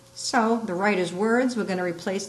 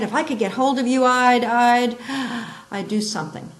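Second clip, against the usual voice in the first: louder, higher, breaking off in anger; frustrated sputter; 5 semitones higher, 6 dB louder than usual. Shown as a demonstration. Teacher acting out an angry speaker, mock furious sputter. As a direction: sputter with rage and stop short. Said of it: "we just recognize the anger".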